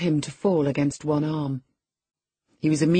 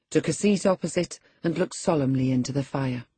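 ordinary delivery

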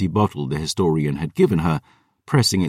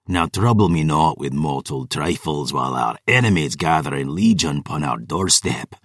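put-on a scornful old count putting his daughter down; cold vampire lord; 3 semitones lower than usual. Nikita's voice in the story.

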